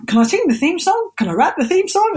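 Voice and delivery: hopeful, high-voiced request; eager asking. Pleading to get singing gigs.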